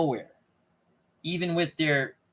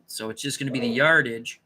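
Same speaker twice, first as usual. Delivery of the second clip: ordinary talk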